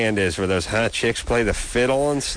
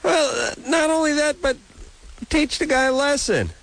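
high, whiny mock sob voice; mock crying whine; 11 semitones higher than usual. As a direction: whine it like a blubbering kid. Host suddenly goes whiny high.

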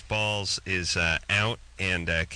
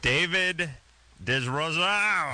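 mock French accent; 8 semitones higher, much slower than usual. Host introducing the guest's name, hammed-up French accent. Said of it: "these guys are French Canadian".

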